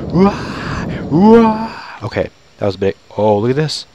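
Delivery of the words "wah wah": high "wah" cries; startled wail. Real, not acted. Player reacting to something big on screen, startled yelps. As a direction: yelp it high, drawn out.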